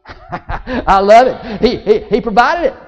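loud laugh into the words; laugh, then savor it slowly; laughing delight. Real, not acted. Preacher laughs at his story.

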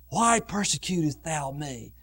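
low, hushed, grave question; soft, deep divine reproach; great voice from heaven. Acted. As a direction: drop to a solemn hush. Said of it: "a great voice came out of heaven".

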